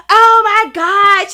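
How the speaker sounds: loud and slow, drawn-out oh my God; excited gasp. Retold or imitated. The classmate recognizing her, slow loud delight. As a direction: stretch it out in delight. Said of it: "she was like".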